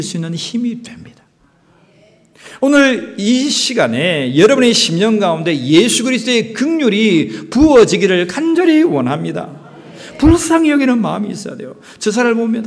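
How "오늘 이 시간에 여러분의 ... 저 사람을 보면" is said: loud, high, urgent preaching; fervent preaching. Acted. Preacher praying over the congregation, fervent loud preaching. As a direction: press the plea on the congregation.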